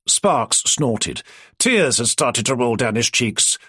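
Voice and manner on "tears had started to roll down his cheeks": usual speaking voice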